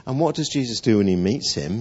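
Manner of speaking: normal talking voice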